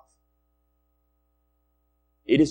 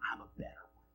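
whispered aside, as Paul boasting; 24 dB quieter, much faster than usual. Acted. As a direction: whisper the boast under your breath. Voicing Paul's preposterous boast.

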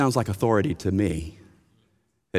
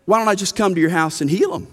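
playful, higher, quick mock offer to heal; 8 semitones higher, faster than usual. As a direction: toss it off like an absurd pastor's promise. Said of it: "if I were to respond to them, we'll say".

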